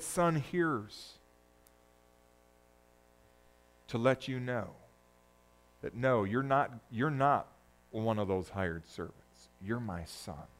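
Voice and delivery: soft, low, gentle reassurance; tender father. Acted. Father quietly telling his son he is no servant, tender. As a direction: hush it like a father comforting.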